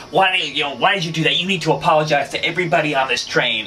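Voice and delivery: angry rider yelling at the woman to apologize, high and loud; imitated high-pitched yelling. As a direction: yell it up high, scolding everyone's behalf. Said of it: "his voice was, like, high-pitched, and he was just yelling".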